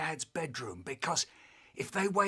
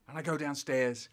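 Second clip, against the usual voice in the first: ordinary delivery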